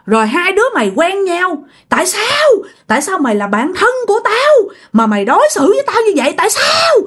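high-pitched, wailing demands to know why; acted jilted spirit's tirade; scolding accusation. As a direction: berate a friend who betrayed you, pitch climbing sharply. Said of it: "Vừa liếc vừa chửi vừa chỉ nha".

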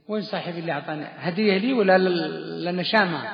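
ordinary talk, nothing marked

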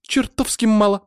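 high, loud agreement; emphatic high-voiced objection; supporting protest. A narrator's reading. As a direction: back up the complaint, pitched high. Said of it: "поддержала подругу Катя".

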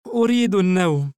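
put-on high, drowsy little boy; tired small child; sleepy child voice. A narrator's reading. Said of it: "نظر الصغير باستغراب".

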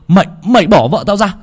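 husband yelling at the thug; angry shout. A narrator's reading. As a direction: order them off his wife.

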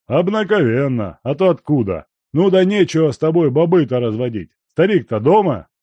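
put-on loud, fast gruff old man; brusque old miner. A narrator's reading. Guest asking the servant for his master, loud and brusque. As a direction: cut the chatter short. Danila speaking.

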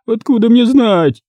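high, slow hopeless moan; Татьяна Андреевна. A narrator's reading. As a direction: wail helplessly, drawn out and high. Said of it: "безнадёжно простонала Татьяна Андреевна".

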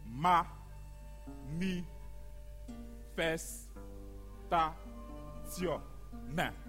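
very slow, broken into separate syllables. Acted. Narrator announcing the town's protest, spelled out syllable by syllable. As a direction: space each syllable out with long pauses.

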